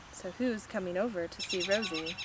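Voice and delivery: usual speaking voice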